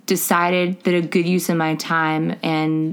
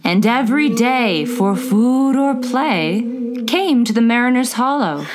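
poem reading. Acted. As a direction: recite it like an old ballad. Reads poem aloud.

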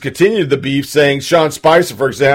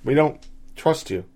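softer, quiet echo; 11 dB quieter than usual. Real, not acted. Repeated quietly.

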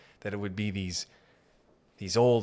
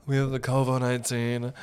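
slightly put-on drag-queen host; sashay through the announcement; drag woman manager. Building manager voiced as a drag woman, lightly campy. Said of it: "it's a drag woman".

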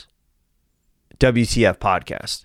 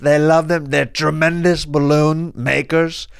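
higher, emphatic presidential caricature; boastful president impression; 7 semitones higher than usual.

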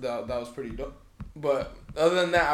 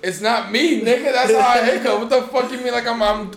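loud, higher indignant protest; 7 semitones higher, 8 dB louder than usual. Real, not acted. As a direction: protest loudly that it's real. Defending himself over his hiccups.

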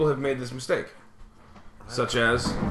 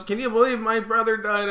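higher, mock-plaintive old lady; grandma voice; 8 semitones higher than usual. Mimicking grandma greeting him, mock plaintive. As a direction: whine it like a needy grandma.

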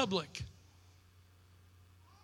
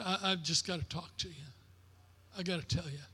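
quiet retold weeping; 4 semitones lower, 11 dB quieter, faster than usual. Weeping son confesses to dad.

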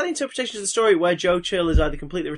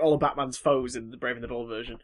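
usual speaking voice

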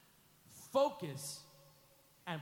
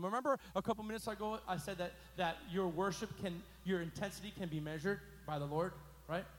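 normal talking voice

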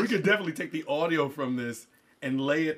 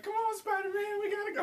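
put-on much higher, a kid's play voice for Tails; high toy voice; 11 dB quieter than usual. Dad voicing his son's toy Tails, high and playful. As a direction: squeak it out like a toy character.

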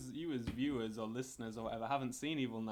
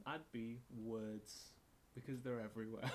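mock poetic; 3 semitones lower, 11 dB quieter than usual. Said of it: "gonna go real poetic".

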